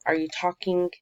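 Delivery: ordinary talk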